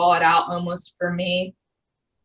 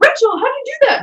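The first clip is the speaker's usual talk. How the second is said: amazed coworkers; 4 dB louder, faster than usual. Retold or imitated. Others amazed by her.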